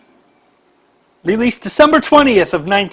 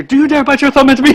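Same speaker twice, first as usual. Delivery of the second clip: movie quote; 6 semitones higher, 4 dB louder, faster than usual. Retold or imitated. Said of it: "I was waiting for you".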